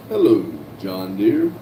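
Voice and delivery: very low, drawn-down hello; mock deep greeting. Reading a viewer's hello to John Deere, mock deep voice. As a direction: sink playfully into a deep register.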